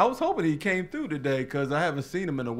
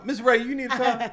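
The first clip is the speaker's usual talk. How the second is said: pitched-up pushy car salesman; 9 semitones higher than usual. Pretend. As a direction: sell her a car, hype it up. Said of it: "he has the car salesman voice on".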